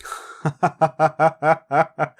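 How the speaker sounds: loud scornful dragon laugh. Acted. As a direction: laugh off the cheeky demand. Dragon laughs at hireling's claim.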